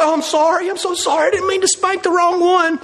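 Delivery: very high, whiny over-the-top apology; mock apology. Dad to wrongly spanked child, exaggerated apology. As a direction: play a dad gushing regret in a squeaky pitch.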